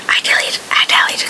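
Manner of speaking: breathy whispered aside; whispering. Real, not acted. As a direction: whisper it playfully. Playful aside about dawdling.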